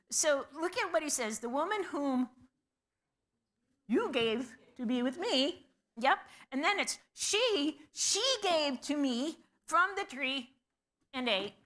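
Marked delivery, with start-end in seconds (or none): 1.4-11.5 higher and a bit louder, pushing the blame; emphatic blame. Acted. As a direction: point at the woman, insistent. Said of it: "like, emphatic in Hebrew".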